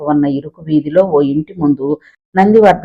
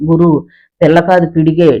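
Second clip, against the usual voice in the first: plain reading voice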